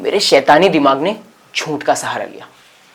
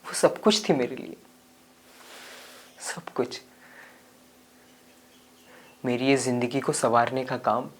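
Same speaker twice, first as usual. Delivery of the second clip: subdued regretful storytelling; 2 semitones lower, 11 dB quieter than usual. Acted. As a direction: confess regret quietly, with pauses. (पछतावे भरी धीमी आवाज़ में)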